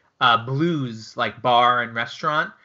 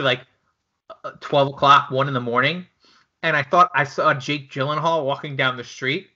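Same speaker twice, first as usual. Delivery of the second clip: ordinary talk, nothing marked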